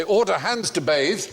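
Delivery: slow, called-out order; naval order. Retold or imitated. Captain calls the order to his crew, slowly. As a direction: call the command out slowly. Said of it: "he might say".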